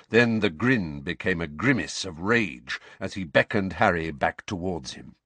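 usual speaking voice

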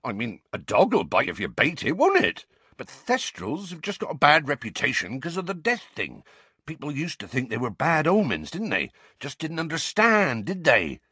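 mildly anxious gamekeeper justifying himself; a little anxious. A narrator's reading. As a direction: argue the case with rising worry. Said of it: "looking a little anxious now".

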